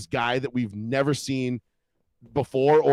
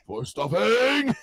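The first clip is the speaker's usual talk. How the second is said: high, slow drawn-out cartoon cry; mock battle cry; 7 semitones higher, 3 dB louder, much slower than usual. Host echoing co-host's South Park gag, mock battle cry. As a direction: stretch out the last word in mock triumph.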